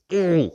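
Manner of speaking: high, loud ooh of rising heat. Retold or imitated. Recalling being poked in the chest, a loud high ooh. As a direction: let out a loud worked-up ooh.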